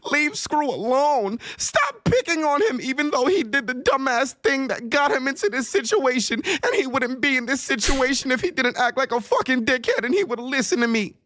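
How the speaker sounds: high mocking whine of a friend; mock him in a high whiny voice. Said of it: "wanted me to tweet and hop on Twitch and say".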